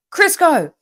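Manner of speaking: loud, high call across. Real, not acted. Calling out to a friend.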